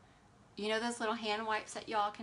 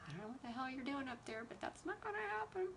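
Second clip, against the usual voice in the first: soft, quick, rising into a high singsong; hushed, high-pitched mock commentary; amused muttered aside; 6 semitones higher, 9 dB quieter, faster than usual. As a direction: say it under your breath, fast, ending high and soft. Amused aside to imagined passenger.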